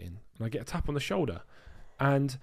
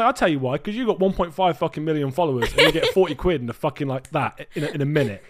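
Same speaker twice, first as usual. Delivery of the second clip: heated comeback; 3 semitones higher, 7 dB louder than usual. Retold or imitated. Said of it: "I say".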